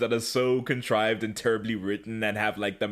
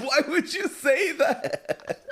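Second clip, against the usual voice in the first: ordinary delivery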